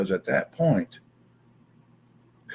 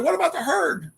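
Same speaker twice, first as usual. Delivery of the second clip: high anxious question; worried friend. Retold or imitated. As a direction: friend asking in alarm. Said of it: "And he's like".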